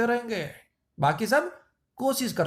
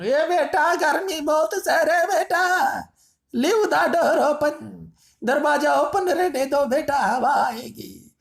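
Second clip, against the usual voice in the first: put-on old parent pleading; 8 semitones higher, slower than usual. Sweltering parent asks son to keep the door open, high slow plea. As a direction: drag it out like a hot, tired parent asking a favour.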